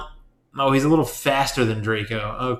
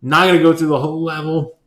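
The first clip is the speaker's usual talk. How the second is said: ordinary talk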